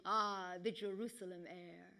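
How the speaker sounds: soft, low, savouring sigh of a line; understated wry contentment; wry chuckling remark. Acted. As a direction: say it quietly with a touch of dry humour.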